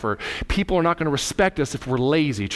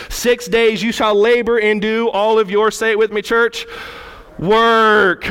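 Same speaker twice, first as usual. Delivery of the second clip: loud, raised preacher's delivery; forceful preaching; 6 semitones higher, 5 dB louder than usual. Real, not acted. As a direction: preach it out hard to the congregation.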